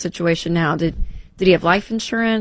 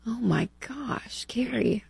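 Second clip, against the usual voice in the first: shocked whisper; 4 semitones higher, 10 dB quieter, slower than usual. Real, not acted. Host hears of husband's death.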